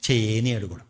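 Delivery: ordinary delivery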